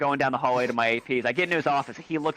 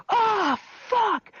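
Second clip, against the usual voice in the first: high, drawn-out mock cry; piercing high mock dismay; mimicked outburst. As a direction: imitate a whole class squealing the curse. Class mimics outburst in unison.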